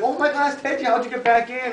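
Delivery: very high excited squeal, imitating the boy; delighted surprise. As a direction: greet a lost pet with amazed joy. Boy finds his pig returned.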